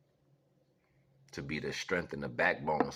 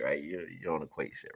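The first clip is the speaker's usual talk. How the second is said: usual speaking voice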